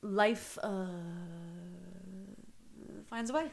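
halting delivery with long pauses; Jeff Goldblum. Acted. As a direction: stall on a drawn "uh", then finish. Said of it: "That's my Jeff Goldblum impersonation".

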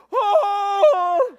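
hammed-up crying howl, high and very loud; long comic wail of grief; mock wail. Owner sees his wrecked truck, exaggerated loud wail. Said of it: "I hadn't cried yet".

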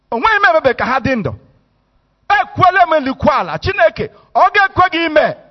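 loud, fast, high mock indignation in Igbo; hammed-up furious demand to wipe them out; mock outraged rant. What some of us would say to God, mock angry Igbo rant. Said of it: "If it were some of us today, we say".

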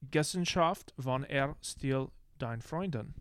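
slow, mock German accent; slow hammed-up German villain accent. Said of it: "you do a German accent well".

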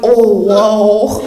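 low, drawn-out dying "oh, whoa"; dying grandma. Retold or imitated. Grandma dying beside granddaughter, low heavy awe. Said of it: "to hear her dying going".

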